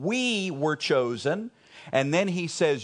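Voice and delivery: normal talking voice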